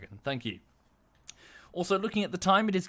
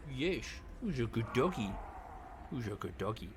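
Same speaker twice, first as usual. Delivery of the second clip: soft dog talk; 2 semitones lower, 9 dB quieter than usual. Real, not acted. Rubbing the dog's belly.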